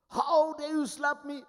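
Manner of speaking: high, loud outraged squeal of a self-proclaimed god; shrill mock outrage at being slapped; indignant retort. A man claiming to be God objects to the slap with outrage.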